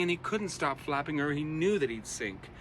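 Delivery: usual speaking voice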